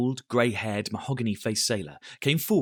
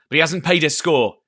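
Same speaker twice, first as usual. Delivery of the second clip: ordering cry; 5 semitones higher, 7 dB louder than usual. A narrator's reading. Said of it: "cried Silver".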